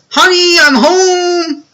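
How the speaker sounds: high, loud sing-song "Honey, I'm home"; dad calling out. Retold or imitated. Dad announcing his arrival to the family, high and loud. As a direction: call it out like a cheerful dad coming through the door.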